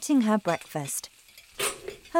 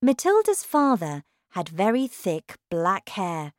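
plain reading voice

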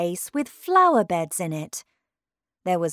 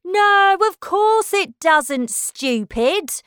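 high, loud scornful outburst; loud, high-pitched retort; crying out; 11 semitones higher, 7 dB louder, slower than usual. Acted. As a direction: cry it out as an indignant daisy. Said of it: "cried another".

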